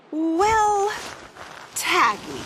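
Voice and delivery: dry punchline after a beat; comic pause. A narrator's reading.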